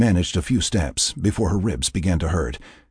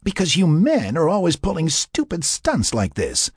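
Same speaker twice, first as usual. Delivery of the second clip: high-pitched, annoyed retort; heated, exasperated comeback; sharp retort; 11 semitones higher than usual. A narrator's reading. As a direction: throw it back at him. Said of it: "she retorted".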